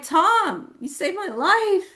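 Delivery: very high, drawn-out exclamation; impassioned insistence. Retold or imitated. Telling the friend why the house bears his name, high impassioned cry. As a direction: cry it out, stretching the last words. Acted emotional reply to friend.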